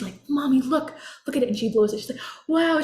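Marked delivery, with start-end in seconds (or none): 0.3-1.5 lightly voiced excited child, higher and louder; excited little girl. Mom voicing her daughter calling her to look, excited. 2.5-2.8 high, loud, delighted wow; excited wow. Retold or imitated. Daughter blowing the dandelion seeds, excited wow. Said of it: "she's so excited".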